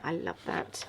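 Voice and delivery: breathy whisper of "I love that"; whispered delight. Real, not acted. Crafter whispering to herself over pretty paper, soft delight. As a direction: whisper it to yourself, enchanted. Admiring paper she picked.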